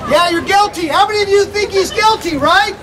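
ordinary delivery

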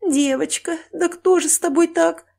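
high, plaintive lament; wailing, pitying question; tearful pity. Acted. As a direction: grieve over an injured girl. Woman sobbing over the injured girl.

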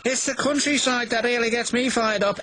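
high-pitched, haughty character voice; boastful engine. A narrator's reading. James boasts, scoffing at Percy.

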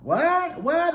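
high disbelieving double what; shocked what. Retold or imitated. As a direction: react in stunned disbelief. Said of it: "I was like".

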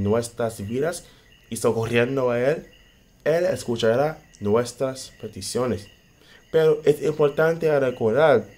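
loud, fervent preacher's exhortation; preaching. Acted. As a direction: preach it out with full force. Preaching climax on prayer.

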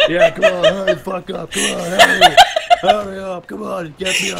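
loud, high laughing shouts; laughing yell. Real, not acted. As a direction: yell through laughter for help. Teammate yelling to be picked up.

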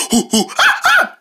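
hoots ending in a sudden high ha; monkey noises. Acted. Quiz game with a friend, a monkey hoot. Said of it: "Guess what animal noise this is".

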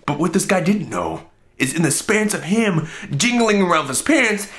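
ordinary talk

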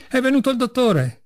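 high-pitched cry at normal volume; exclaimed cry. A narrator's reading. Madman announcing the doctor to the ward, mildly crying out. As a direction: call out the news, pitched high. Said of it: "gridò".

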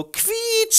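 sehr hohes Quietschen vor Freude; Freudenquietschen. Acted. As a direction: quietsch entzückt auf. (Mieze quietscht entzückt)

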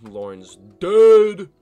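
drawn-out yell leaping high at the end; high, booming cry; loud yell. Real, not acted. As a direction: build to a huge, long shout on the last word. Calls out news of a fight's end.

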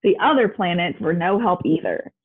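ordinary delivery

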